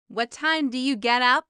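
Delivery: ordinary talk, nothing marked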